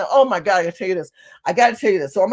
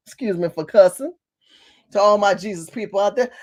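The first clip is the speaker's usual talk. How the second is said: ordinary talk, nothing marked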